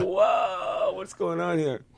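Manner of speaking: high, softened astonished voice; comic retold disbelief; mock bewilderment. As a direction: act taken aback, pitched high. Retold shock at Texan parents.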